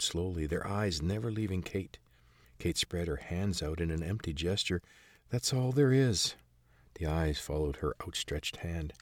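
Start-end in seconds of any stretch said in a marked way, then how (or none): 5.3-6.4 put-on apologetic girl. Kate shows empty hands.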